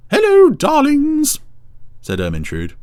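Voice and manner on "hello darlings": very high, slow, louder cow; campy drag queen. A narrator's reading. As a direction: purr it out grandly, slow. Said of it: "I want to do the voice".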